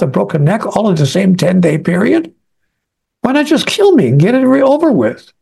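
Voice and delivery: high-pitched, exasperated railing; retold angry outburst. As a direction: rail at heaven, pitch swooping up without shouting. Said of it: "So I'm very angry".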